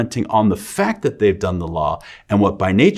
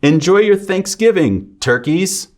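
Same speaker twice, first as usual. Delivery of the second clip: bright, much higher call to the "turkeys"; cheery greeting; 10 semitones higher, 4 dB louder, slower than usual. Shown as a demonstration. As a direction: hail the "turkeys" like a host.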